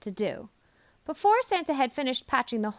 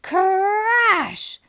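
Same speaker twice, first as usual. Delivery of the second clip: a loud burst, much higher; loud crash; 8 semitones higher, 9 dB louder than usual. A narrator's reading. Narrator calls the sleigh crash loudly. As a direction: bang out the crash word.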